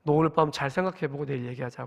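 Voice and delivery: low, softened retold parent's voice; quiet restraint. Father retelling words to his teenage son, quietly restrained. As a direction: hold back the scolding, speak low and soft.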